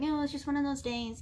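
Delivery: mock flippant shrug; mock excuse. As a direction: brush it off like a cheap excuse. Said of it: "I'm not trying to make an excuse and be like".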